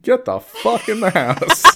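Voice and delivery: high, slow laughing yell; mock yell. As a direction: bellow it through laughter. Movie quote yelled with laugh.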